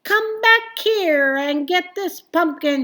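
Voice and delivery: high, loud call after the truck; angry yell at the driver. Acted. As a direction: shout at the departing driver. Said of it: "she yelled".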